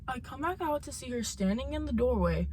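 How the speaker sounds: ordinary talk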